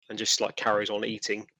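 usual speaking voice

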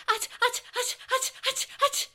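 high, loud yelps of a scalded tongue; burned tongue. Acted. Boy scalded by the hot mushroom, yelping high. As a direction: yelp as the hot mushroom burns.

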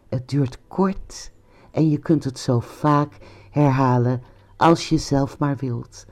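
normal talking voice

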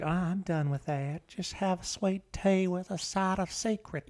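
softly crooning creepy charmer; a hint of a creepy seducer; creepy Southern charmer. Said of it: "You sound like the creepy guy on Family Guy".

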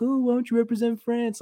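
high, sing-song mocking whine; mock whiny demand. Mocking the French journalists with a high, whiny mock demand. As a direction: parody the hypocritical reporters.

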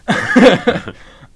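high loud burst of laughter. Real, not acted. Host cracking up at cohost's joke, loud laugh. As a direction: let out a big laugh.

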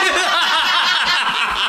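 high-pitched helpless cackle; high laughing fit. Real, not acted. Telling friends about the 38 goals, cracking up. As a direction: let the laugh run away with you. Cousin conceded thirty-eight goals.

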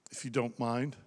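usual speaking voice

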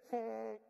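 loud honking goose call. Shown as a demonstration. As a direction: honk it out like a goose. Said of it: "That's my best goose impression right there".

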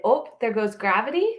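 ordinary talk

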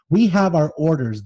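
curt, loud bark of an officer. Acted. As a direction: snap the order back at her. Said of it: "the officer practically barked at me".

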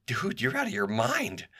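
high, drawn-out disbelief; incredulous. Retold or imitated. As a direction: stretch it out high, as if he's crazy. Retold incredulous retort to the sheriff.

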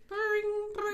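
high sing-song ring-ring; phone ring imitation. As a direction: chirp it like a ringing phone.

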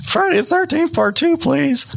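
put-on high, cracking kid voice. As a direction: squeak it out like a nervous kid. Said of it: "And your voice cracks".

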